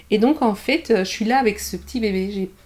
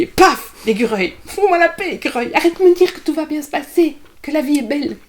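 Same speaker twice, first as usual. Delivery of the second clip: loud, higher-pitched comic telling-off; mock angry outburst; 6 semitones higher, 7 dB louder than usual. Woman retelling her rant at a cheerful squirrel, mock exasperated. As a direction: burst out as if fed up, playing it up.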